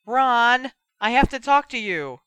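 high, loud yell to another room. A narrator's reading. Sister summoning her brother from another room, yelling. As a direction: holler for your brother. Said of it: "she yelled".

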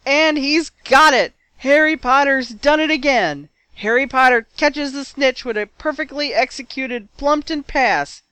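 high, loud, elated play-by-play; match commentator. A narrator's reading. Lee calling Harry's winning catch, thrilled commentary. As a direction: announce the catch to the whole stadium.

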